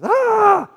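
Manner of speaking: a sudden sharp high "Ah!" bursting out; pressure release cry. Shown as a demonstration. Pastor shows the congregation another pressure-release sound, high sharp yelp. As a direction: let the pressure pop out in one yelp. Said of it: "whatever your pressure release sound is".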